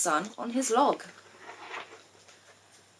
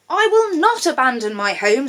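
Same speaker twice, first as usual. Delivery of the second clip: louder, firmly stubborn frog; stubborn refusal; 6 semitones higher, 8 dB louder than usual. A narrator's reading. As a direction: dig in and refuse.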